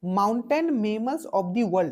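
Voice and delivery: book title read out slowly, word by word. Shown as a demonstration. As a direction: spell the title out deliberately. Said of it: "reading book title slowly". (शीर्षक धीरे-धीरे पढ़ते हुए)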